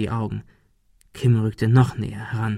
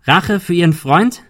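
loud, higher challenging question; calling out; 6 semitones higher, 5 dB louder than usual. A narrator's reading. Ben presses the gunman for his motive, calling out. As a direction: call it out, rising at the end. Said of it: "rief Ben".

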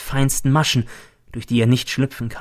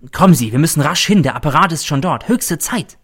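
higher, pressing, rising at the end; urgent; 4 semitones higher, 4 dB louder than usual. Acted. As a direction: urge your colleague to come at once. Cäsar urges colleague to hurry. (drängend und aufgeregt)

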